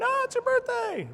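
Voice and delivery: a silly high voice, as if from the dog in a party hat; squeaky party voice. Retold or imitated. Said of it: "with party hats on going".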